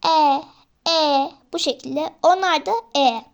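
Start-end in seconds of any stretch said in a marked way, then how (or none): 0.1-1.3 "E" said with the mouth open like A, louder; corrected E vowel. Shown as a demonstration. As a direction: demonstrate "E" from an A-shaped mouth. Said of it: "E, şöyle pardon".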